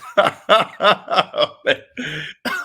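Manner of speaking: cracking up at the memory; high burst of laughter. Real, not acted. Laughing at the name.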